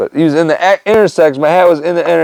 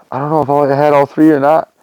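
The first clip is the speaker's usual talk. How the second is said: ordinary talk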